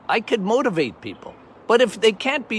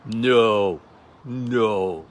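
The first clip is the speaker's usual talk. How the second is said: low, dismissive doctor voice; imitating the physician; 6 semitones lower, slower than usual. Retelling the physician's brush-off, low and dismissive. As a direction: lower the voice, brush the question off. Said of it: "he said".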